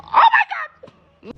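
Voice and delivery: high, loud, drawn-out Oh my God; excited. Real, not acted. Fan ending her story with a loud drawn-out shriek. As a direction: shriek it out slowly in disbelief. Laughing exclamation at end.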